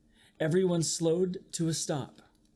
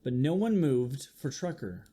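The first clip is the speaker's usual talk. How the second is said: ordinary delivery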